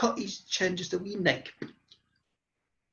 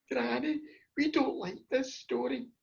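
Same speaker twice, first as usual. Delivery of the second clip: put-on higher, softer child's plea; children protesting; 4 semitones higher, 6 dB quieter than usual. Grandchildren beg granny to stop, small high voice.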